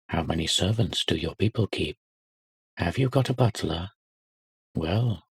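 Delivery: a hint of mean-minded schoolboy grilling; snobbish interrogators. Boys interrogating a new boy.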